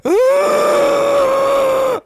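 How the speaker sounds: long high loud scream; pained scream. Retold or imitated. Kid hit by the brother, long high loud scream. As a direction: howl as the hit lands. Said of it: "You'd be like".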